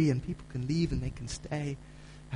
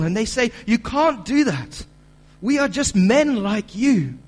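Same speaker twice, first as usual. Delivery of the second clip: louder, higher, pleading preacher's cry; preaching at full force; 6 semitones higher, 8 dB louder than usual. Acted. Preacher retelling apostles refusing worship, loud and urgent. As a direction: preach it out, urging them not to worship. Preacher builds to Paul's plea.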